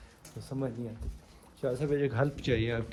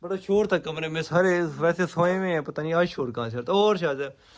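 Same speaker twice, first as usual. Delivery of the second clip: loud, high, showy grumbling about a noise; loud put-on annoyance at a mystery noise; pretend complaint about noise; 4 semitones higher, 6 dB louder than usual. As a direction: complain loudly so the guests feel ignored. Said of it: "ہم کہیں گے شور آ رہا ہے".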